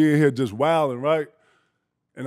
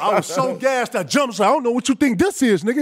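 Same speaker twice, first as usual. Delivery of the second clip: ordinary talk, nothing marked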